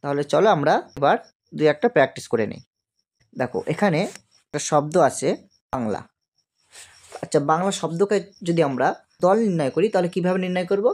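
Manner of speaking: normal talking voice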